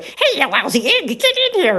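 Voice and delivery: high, far-off cartoon dog voice, rising sharply; Gnisi the dog's gruff voice. Shown as a demonstration. Creator voicing Gnisi the dog putting Nikki down, high cartoon voice. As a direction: bark it out as a pushy cartoon dog. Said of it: "I can have him say".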